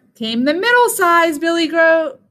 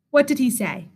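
usual speaking voice

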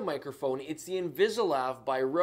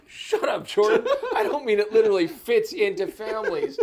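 laughing; 4 semitones higher, 7 dB louder than usual. Real, not acted. Cracks up at co-host's joke.

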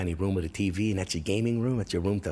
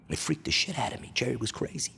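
ordinary talk

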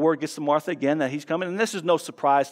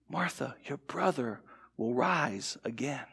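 soft, slow and tender; gently; 5 semitones lower, 10 dB quieter than usual. Shown as a demonstration. Pastor reading Jesus comforting Martha, very gently. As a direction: comfort her quietly. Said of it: "he says this statement gently".

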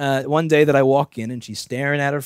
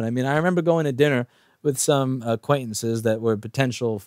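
normal talking voice

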